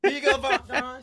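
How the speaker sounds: teasing high voice. Pretend. Teasing offer.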